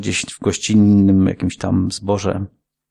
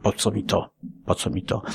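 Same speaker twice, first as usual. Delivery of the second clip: muttered complaint fading to a whisper; quiet grumbling; 5 semitones lower, 6 dB quieter than usual. Retold or imitated. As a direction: grumble it under your breath. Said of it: "to najwyżej narzekasz".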